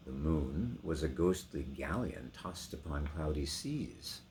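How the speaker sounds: rolling, theatrical reading with big swells; dramatic poem recitation. Acted. Actor reading The Highwayman to his sons, swelling and dramatic. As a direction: declaim it like a ghost story by the fire.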